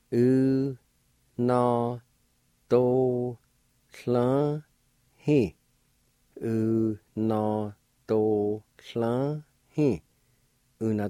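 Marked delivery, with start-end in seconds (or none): 0.1-5.5 very slow, long held syllables; teacher sounding a word out slowly. Shown as a demonstration. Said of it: "I'll pronounce these words". 6.4-10.0 slow, separated syllables; measured teaching pronunciation. Shown as a demonstration. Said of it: "I'll pronounce these words".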